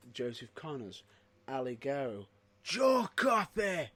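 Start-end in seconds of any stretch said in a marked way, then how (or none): 2.6-3.9 slow, loud name call like a ring announcer; announcer shout. Acted. Hyping a wrestler to his co-host, slow loud ring call. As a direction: bellow the name out, drawn out.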